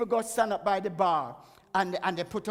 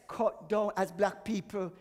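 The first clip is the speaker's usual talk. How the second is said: normal talking voice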